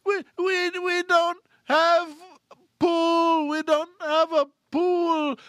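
very high, loud, drawn-out mock sobbing; acted hysterical maid; tearful wail. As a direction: stretch each word into a slow wail. Distraught maid wails her answer.

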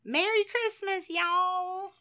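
high-pitched Southern US greeting; Southern accent. Acted. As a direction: put on a Texas accent, pitched well up. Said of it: "But then they would say".

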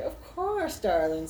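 high, sing-song camp voice; flamboyant shopkeeper. Acted. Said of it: "And he's like".

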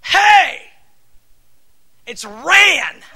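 put-on loud, high yelling at a child; hammed-up harsh correction; mock angry shout. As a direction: pretend furious parent barking. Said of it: "But I don't go".

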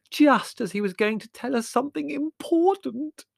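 very high, loud wail; howling. A narrator's reading. Margot robbed of the spirit's important message, howling. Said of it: "howled Margot".

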